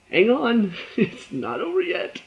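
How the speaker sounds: laughing, high and slow, hang on; laughing tease. Pretend. Vlogger teasing viewers about the twist, laughing. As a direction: tease through a laugh, drawn out.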